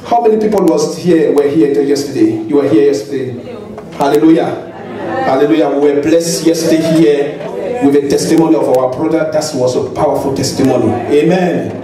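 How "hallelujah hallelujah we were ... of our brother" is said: normal talking voice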